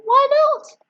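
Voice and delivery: high, daring shrug; decide recklessly; boy deciding on a second dose. Acted. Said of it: "he thought".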